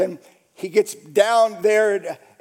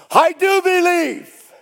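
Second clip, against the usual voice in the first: high, loud, stretched cry; screamed declaration; 11 semitones higher, 6 dB louder than usual. Retold or imitated. Father shrieking out his faith, screamed declaration. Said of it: "He screamed".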